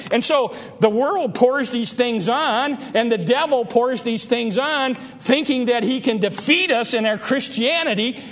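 rising triumphant sermon peak; preaching at full force. Acted. Preaching climax.

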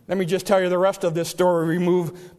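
ordinary talk, nothing marked

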